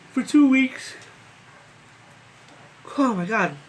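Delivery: high, loud fed-up groan of words; exasperated. Real, not acted. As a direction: vent it, fed up with waiting. Venting about being stuck home.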